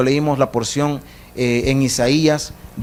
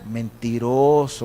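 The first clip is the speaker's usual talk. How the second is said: low, slow, drawn-out dismissal; disbelieving rebuke; 6 semitones lower, 4 dB quieter, much slower than usual. Acted. God to a sinner making promises, disbelieving rebuke. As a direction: drag the word out, unconvinced.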